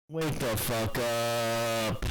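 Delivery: slow, loud wake-up call; slow shouted exasperation; loud exasperated. Real, not acted. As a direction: drag it out loudly.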